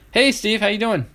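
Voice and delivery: cheery greeting. Real, not acted. Greeting a viewer.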